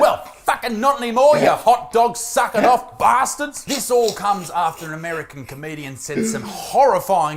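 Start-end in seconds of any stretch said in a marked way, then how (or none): 0.0-3.6 loud, high indignant rant; mock outrage. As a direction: fire back with comic fury, drawing out the insult. Mock rant at insulting Americans.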